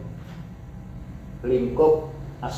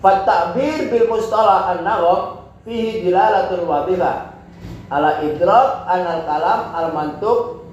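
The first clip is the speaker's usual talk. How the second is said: Arabic text reading; 4 semitones higher than usual. Acted. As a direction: read the Arabic passage out formally. Reads Arabic text aloud.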